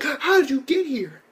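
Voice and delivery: mock frightened girl. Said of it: "she's like".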